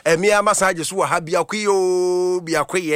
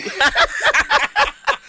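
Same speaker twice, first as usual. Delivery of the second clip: a burst of laughter jumping way up high; high squealing laugh. Real, not acted. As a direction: let a high laugh escape at the joke.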